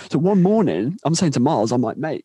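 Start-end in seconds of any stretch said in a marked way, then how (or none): none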